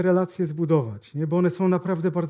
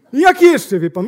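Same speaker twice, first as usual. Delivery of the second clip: "I jak jeszcze?" said high and loud as a hammed-up salesman; mock bellowed repeat of "I jak jeszcze?"; 6 semitones higher, 10 dB louder than usual. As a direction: shout "I jak jeszcze?" higher, mocking the eager salesman.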